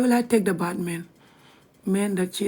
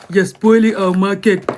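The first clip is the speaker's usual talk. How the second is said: louder mock outrage in a put-on voice; mock angry hater, louder; 9 dB louder than usual. A jealous rival scolding her, in louder put-on outrage. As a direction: ham up the jealous rival's complaint. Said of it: "They're like".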